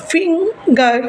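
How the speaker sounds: Finger slowly broken as "fing - gar"; finger drawn out syllable by syllable; split into syllables. Shown as a demonstration. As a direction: say finger in two separate beats. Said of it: "আমি একবারে যতটা উচ্চারণ করতে পারছি".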